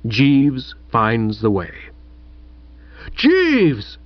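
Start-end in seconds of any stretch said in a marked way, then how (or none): 3.1-4.0 high-pitched cry, falling away; bellow. A narrator's reading. Chuffy calls for the butler, bellowing. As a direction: bellow the name like a summons. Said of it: "bellowed Chuffy".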